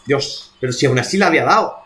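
ordinary talk

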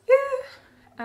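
high, loud squeal of delight; excited cheer. Real, not acted. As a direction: let out a quick high cheer. Cheers about loving the ukulele.

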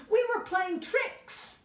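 high and loud giggly cub; high cheeky cub. Acted. Middle bear owning up to tricks, high cheeky voice.

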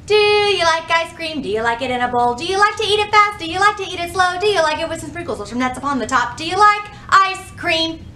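racing through the song at double speed; breathless speed-singing. Shown as a demonstration. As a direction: rattle it off as fast as you can. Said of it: "even faster".

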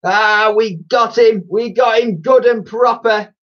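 loud, high gloating shout; whooping villainous delight; hooting with glee. A narrator's reading. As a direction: crow triumphantly over a victim. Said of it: "collapse in hoots of laughter".

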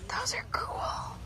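high breathy whisper of awe. Real, not acted. Admiring a plant in the garden center, whispered. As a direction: breathe it out in wonder.

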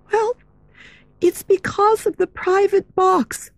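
put-on high, hushed girlish voice; secretive girl voice. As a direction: confide it nervously. Meg's voice.

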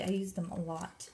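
usual speaking voice

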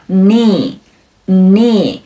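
"knee" said slowly as "nee"; silent K. Shown as a demonstration. Teacher showing the silent letter, "knee" with silent K. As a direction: start "knee" straight on the N. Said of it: "开头的字母K是不发音的".

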